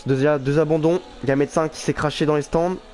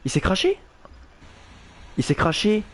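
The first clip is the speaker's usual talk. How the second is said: surprised; 5 semitones higher than usual. Real, not acted. Driver crashes live.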